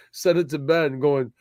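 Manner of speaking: ordinary talk, nothing marked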